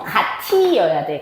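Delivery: 같이 said 가티, with a t sound; unpalatalized 같이. Shown as a demonstration. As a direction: slowly link ㅌ straight into 이 in 같이, ga-ti. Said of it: "원래는 뒤로 연대 뭐가 돼야 돼?".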